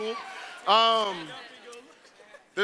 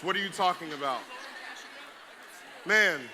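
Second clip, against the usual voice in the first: usual speaking voice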